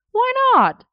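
very high, loud squawk of objection; indignant protest. A narrator's reading. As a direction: protest in a sudden high-pitched whine. Merlin protests the no-couple rule.

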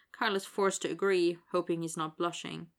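usual speaking voice

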